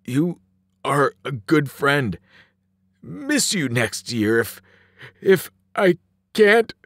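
halting, sniffly and slow; slow, broken, tearful delivery; trembling voice. A narrator's reading. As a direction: falter toward tears, rising at the end. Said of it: "His voice trembled".

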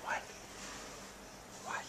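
whispering. Real, not acted. Parent whispering to toddler on the furniture, hushed whisper. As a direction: keep it secret, whisper so nobody hears. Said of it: "keep it quiet".

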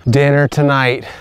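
ordinary delivery

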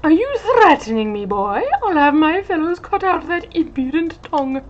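pompous captain demanding restitution; haughty dandy captain. Acted. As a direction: order the errand boy about. Captain threatens.

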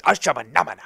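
chanted nonsense word in a strange voice; mock magic word; made-up charm. Shown as a demonstration. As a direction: intone it like a spell. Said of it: "that is what I say".

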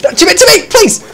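high-pitched, urgent begging; frantic pleading. Real, not acted. Gamer begging a teammate for help, frantic pleading.

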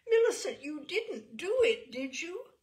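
high, loud anguished cry; cried out. A narrator's reading. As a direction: cry it out in horror. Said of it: "Missus Skinner cried out".

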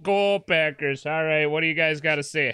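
root for the team in a hammed-up Wisconsin accent; playful Midwestern Wisconsin voice. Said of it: "a podcast in that accent".

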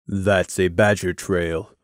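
low, slow and blunt. Acted. Crimson bluntly correcting Scenic, low and slow. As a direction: state it flatly, no patience. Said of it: "Crimson bluntly informed Scenic".

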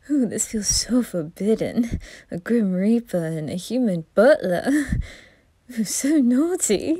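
campy reaper flirting, giggly and teasing; flirty Grell. Acted. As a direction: seduce the listener playfully. Grell flirts with listener throughout.

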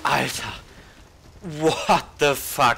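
loud, slow startled yell; startled shout. Real, not acted. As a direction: shout it in sudden shock. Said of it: "mega erschrocken". (erschrockener Aufschrei)

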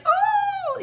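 very high, loud excited "oh"; giddy squeal. Retold or imitated. Traveler reliving the moment of landing in Rome, giddy squeal. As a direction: reenact a giddy squeal of delight. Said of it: "I'm so giddy because".

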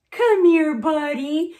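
loud, high, hearty call to a dog; woman calling dog. Retold or imitated. Woman takes one look and calls the dog, loudly. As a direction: imitate a neighbour hollering for a puppy. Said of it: "and she goes".

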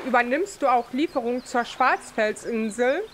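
normal talking voice

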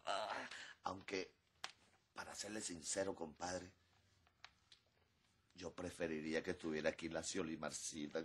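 ordinary talk

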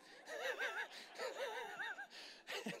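high, soft burst of laughter; amused high-pitched laughing fit; real laughter. Real, not acted. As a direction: laugh helplessly at your own joke. Preacher laughs at own joke.

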